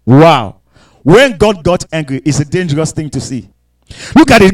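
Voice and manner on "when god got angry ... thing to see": ordinary delivery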